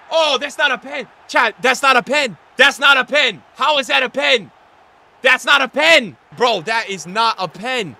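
loud, repeated complaint to chat; indignant protest. Real, not acted. Streamer complaining to chat about the penalty, loud indignant protest. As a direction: keep hammering the complaint.

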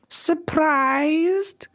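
high, mocking crone voice; wicked fairy springs her surprise; sly old fairy Griselda. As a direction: taunt the king with one high word. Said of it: "said Griselda".

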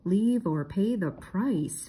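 very slow, ominous reading; reading a threat. Acted. Fred reads the scarecrow's sign aloud, slow and ominous. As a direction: draw out every word like a warning. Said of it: "Fred read".

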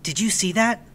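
put-on whisper-urgent kid asking his friend; boy startled, asking his friend; young Oliver. A narrator's reading. Boy alarmed in classroom.

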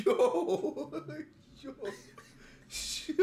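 high, drawn-out yo through laughter; real, high-pitched laughing exclamation; laughing outburst. Real, not acted. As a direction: crack up in disbelief at the clip. Bursts out laughing at the clip.

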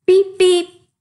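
very high, loud playful honk; grinning beep. Acted. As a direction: toot it with a cheeky grin. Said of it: "he said with a little blue grin".

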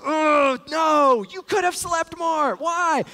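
put-on half-joking frustrated outburst at the baby; mock anger. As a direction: play up the grumpy early-morning dad. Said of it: "But I, anger, I'm like".

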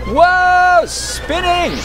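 high, loud dizzy excitement; excited whoa. Real, not acted. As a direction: ride the spin with glee. Character spinning on screen.

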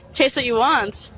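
normal talking voice

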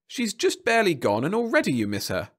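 high, gleeful official; delighted. A narrator's reading. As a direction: relish the caller's longing. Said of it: "rubbed his hands in delight".

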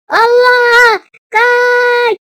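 high, loud cries to flee; panicked shout. Real, not acted. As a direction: scream a warning to run. Flees from killer. (panikle bağırarak)

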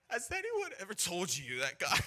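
high, loud, rattled opening line; panicked recital. Retold or imitated. Young evangelist facing big armed man, panicked recital. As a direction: blurt the rehearsed opener in a panic.

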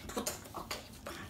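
breathy whisper, disgusted. Real, not acted. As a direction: whisper it in defeat.

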